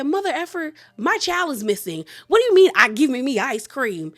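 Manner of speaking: high, loud, indignant outburst; outraged. Retold or imitated. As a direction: blow up at the absurd offer. Summer outraged at ice cream offer.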